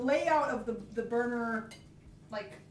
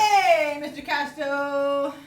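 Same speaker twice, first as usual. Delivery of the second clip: much louder, slow drawn-out call; loud greeting; 4 semitones higher, 11 dB louder, much slower than usual. Real, not acted. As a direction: call out the name. Calling greeting to viewer.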